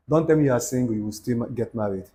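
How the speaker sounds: ordinary delivery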